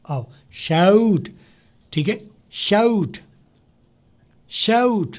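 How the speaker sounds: शाउट stretched slowly, "sha-oot" glide; slow shout demo. Shown as a demonstration. Teacher slowly modelling शाउट for students, stretched. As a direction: draw out the "aa-o" in शाउट.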